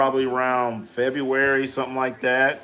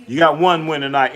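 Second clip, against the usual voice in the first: ordinary talk, nothing marked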